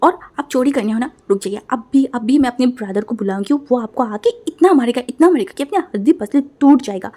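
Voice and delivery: heated loud warning; angry threat. Acted. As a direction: threaten him hotly. Quarrel, threatening brother.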